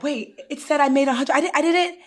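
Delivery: a touch of disbelief, acting out the client; confused protest. Tax adviser voices a startled client, confused protest.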